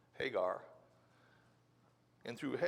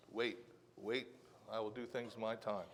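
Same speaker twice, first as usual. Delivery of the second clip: softer, lower, soothing voice of God; God's calm reply; 5 semitones lower, 9 dB quieter than usual. Retold or imitated. God tells Elijah to wait, soft and reassuring. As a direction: answer gently, calm and patient. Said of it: "God told Elijah the same thing".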